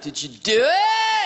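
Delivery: high, shouted punchline question; insistent nosy friend, rising to a shout; eager prodding. Acted. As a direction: press for gossip, voice leaping on "do it". Friends press him eagerly.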